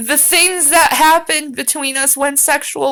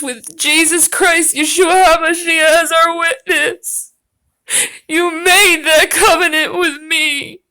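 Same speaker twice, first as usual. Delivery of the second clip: higher, hammed-up wounded lover; mock tearful plea; 4 semitones higher than usual. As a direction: plead like a jilted lover, half-crying in jest. Parodying the woman's tearful plea.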